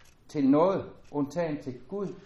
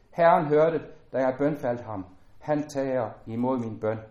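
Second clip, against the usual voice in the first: louder, declaiming psalm voice; solemn scripture reading; 3 semitones higher, 6 dB louder than usual. Acted. Preacher reading a psalm to the congregation, solemn and louder. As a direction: lift the psalm out to the hall, solemn and full.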